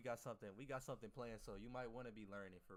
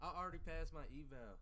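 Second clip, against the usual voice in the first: higher and louder, thrilled to announce it; excited boast; 6 semitones higher, 7 dB louder than usual. Real, not acted. Streamer telling chat he passed his eval, excited. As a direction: blurt out the good news with a grin. Bragging about passing eval.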